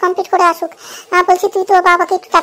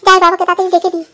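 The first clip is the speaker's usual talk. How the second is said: ordinary talk, nothing marked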